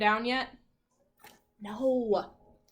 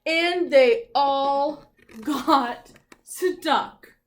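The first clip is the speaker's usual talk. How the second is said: dramatic reading; 4 semitones higher, 9 dB louder, much slower than usual. A narrator's reading.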